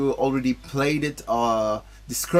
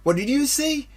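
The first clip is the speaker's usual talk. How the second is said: lightly voiced young heroes, a bit higher; game dialogue voices; 7 semitones higher than usual. Streamer reading game dialogue to his chat, lightly voiced. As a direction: read the cutscene lines with a little character.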